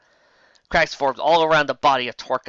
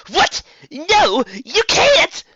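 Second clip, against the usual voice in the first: high, loud, frantic cry; panicking enchantress; panicked villain; 8 dB louder, much slower than usual. A narrator's reading. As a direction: shriek it as your power slips away. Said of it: "said the panic enchantress".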